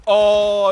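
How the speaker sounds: long, loud, high "oh"; dismayed "oh". Real, not acted. Caster watching a failed dolphin dive, loud high "oh". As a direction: cry out a drawn-out high "oh".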